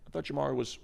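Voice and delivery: normal talking voice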